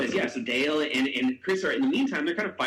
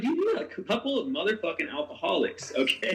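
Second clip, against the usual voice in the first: high-pitched incredulous telling-off; retold rant, pitched up toward the punchline; exasperated scolding; 7 semitones higher than usual. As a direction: scold them in comic disbelief. Retells scolding his fighting friends.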